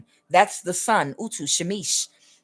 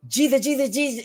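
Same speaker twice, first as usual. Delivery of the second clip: imitating mother, Jesus pitched higher and quicker; higher, quicker praying; 7 semitones higher, faster than usual. Mother starts praying faster as God told her, higher. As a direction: lift the voice and rush the name. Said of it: "she started praying like this".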